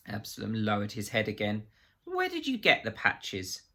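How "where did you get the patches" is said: much higher, probing question; sharp question. A narrator's reading. Reeves pressing Absalom for the source, high and sharp. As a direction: ask it pointedly, up high.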